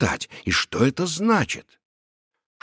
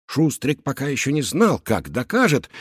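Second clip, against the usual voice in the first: ordinary delivery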